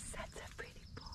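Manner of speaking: soft whispered praise. Real, not acted. Owner whispers praise to the good dog. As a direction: breathe it to the dog.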